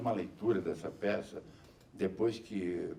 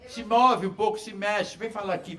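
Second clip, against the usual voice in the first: calling out; 11 semitones higher, 8 dB louder than usual. Real, not acted. Urging the camera operator.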